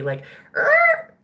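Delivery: very high squeaky mock noise; a cartoon "er?" of dismissal; spirit's squeaky mock sound; mock squeak.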